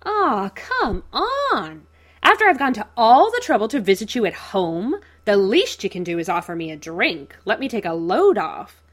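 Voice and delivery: loud, gleeful, stretched-out villain voice; beaming Joker. A narrator's reading. Joker to Bruce at his door, gleeful loud villain. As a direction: barge in, grinning, demanding a drink. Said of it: "Joker beams at him".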